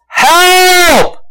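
high, loud cry for help; desperate yell. Retold or imitated. Peter sinking in the storm, yelling for help. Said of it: "He yells".

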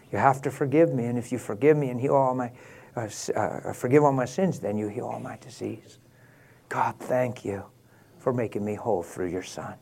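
very soft, private prayer; hushed, low-key talk with God; simple prayer. Shown as a demonstration. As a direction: pray quietly and plainly, straight to God. Models a personal prayer.

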